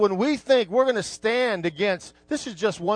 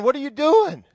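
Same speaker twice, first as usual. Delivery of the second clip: higher, louder mocking question; mocking God; 6 semitones higher, 5 dB louder than usual. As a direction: scoff at them from above, amused. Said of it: "He's going".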